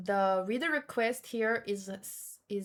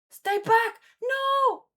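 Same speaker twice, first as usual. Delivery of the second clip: much higher, louder warning yell; hammed-up panicked warning; urgent shout; 6 semitones higher, 6 dB louder than usual. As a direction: shout them back in alarm. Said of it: "voicing the characters' warning".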